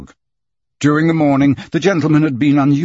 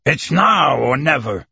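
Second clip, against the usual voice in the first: slow ultimatum; 2 semitones higher than usual. A narrator's reading. Proctor issuing ultimatum.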